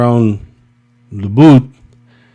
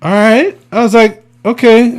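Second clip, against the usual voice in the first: much higher, small voice; meek compliance; 11 semitones higher, 4 dB louder than usual. Retold or imitated. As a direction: squeak out a timid yes. Meek reply to scolding manager.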